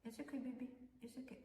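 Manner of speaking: soft, soothing pet talk; calm the dog gently. Real, not acted. Soothing dog while cutting.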